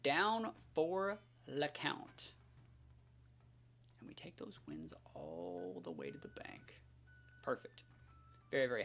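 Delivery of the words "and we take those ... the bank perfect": a gloating whisper. Real, not acted. Player gloating to viewers after the win, whispered. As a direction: breathe it out like a secret boast. Quiet gloating over a won game.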